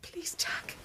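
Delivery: whispered, pleading please. Acted. Wife caught out at night, whispering her plea to Jack. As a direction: beg him under your breath.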